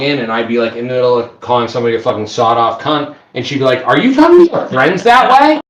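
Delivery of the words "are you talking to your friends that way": high, louder mock wife scolding. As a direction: play the nagging partner, hammed-up. Wife scolding retold in story.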